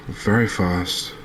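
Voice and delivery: ordinary talk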